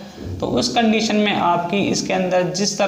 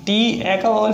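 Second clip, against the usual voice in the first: ordinary talk, nothing marked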